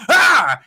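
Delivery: sudden loud, high "Ah!"; jump-scare shout imitating the actor; startling yell. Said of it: "the guy who's in the mocap suit goes".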